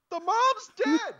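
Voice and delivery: slow, very high, loud outburst; exasperated shout. Retold or imitated. Onlooker to his oblivious partner, exasperated high shout. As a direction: shout it in sheer disbelief, drawn out.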